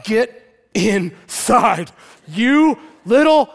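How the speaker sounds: very slow, high, forceful order; exasperated dad. Acted. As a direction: stretch each word in mock fury. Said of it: "I'm like".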